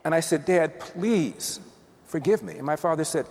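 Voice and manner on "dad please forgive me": higher, pleading retelling; mildly acted plea for forgiveness; retold tearful plea. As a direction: plead lightly, as if through tears. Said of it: "And now I'm crying".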